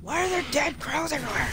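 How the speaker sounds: lifted high in baffled disbelief; high-pitched creeped-out question; high bewildered. Real, not acted. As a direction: squeak out the question. Finds dead crows.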